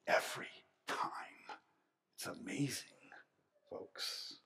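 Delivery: hushed, breathy emphasis; intimate whisper. Real, not acted. Preacher leans in to the congregation, whispering. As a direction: drop to a whisper to draw them in.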